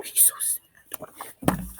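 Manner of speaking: pitying whisper. Real, not acted. Pitying whisper at sad character.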